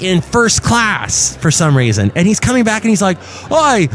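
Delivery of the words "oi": high, slow, put-on Australian accent; mock Aussie bloke, hammed up. Podcast host imitates the angry Australian passenger in an Australian accent. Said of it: "That's about as Australian as I'll get".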